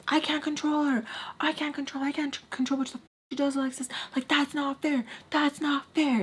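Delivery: high-pitched mock complaint; imitated whiny protest. Storyteller mimicking her ex defending himself about his cousin, high whine. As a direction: pitch it up into a repeated, pleading protest.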